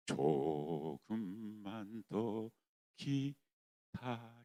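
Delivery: very slow, soft, low answering voice; slow hushed divine reply; voice of God. Acted. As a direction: let God answer gently and slowly. God's voice answering prayer.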